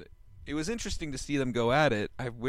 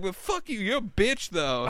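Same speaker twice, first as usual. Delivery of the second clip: put-on high, loud drunken jab; drunk Seth; 10 semitones higher, 5 dB louder than usual. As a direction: toss the insult back playfully. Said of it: "You're like".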